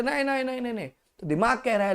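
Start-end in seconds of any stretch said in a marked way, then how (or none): 0.0-0.9 high, quick repeated no; the conscience shaking its head; inner voice refusing. Acted. Inner conscience answering the law with a quick refusal. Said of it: "अंदर से".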